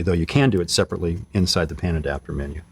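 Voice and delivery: ordinary delivery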